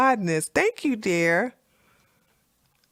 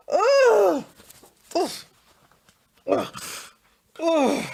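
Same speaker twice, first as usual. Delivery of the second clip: disgusted ugh; 8 semitones higher, slower than usual. Real, not acted. Disgust groans.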